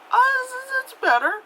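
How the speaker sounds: very high, loud squeaky concession; grudging mock approval. Viewer judges a slightly better sequel, squeaky grudging approval. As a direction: squeak out half-hearted approval.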